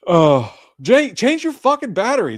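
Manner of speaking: high, loud exasperated yell. Real, not acted. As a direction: shout at Jay to fix it. Yelling at Jay.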